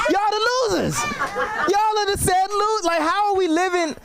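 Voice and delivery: high, exasperated disbelief at the crowd; incredulous rant. Real, not acted. Comic mocking the president's fans, high and incredulous.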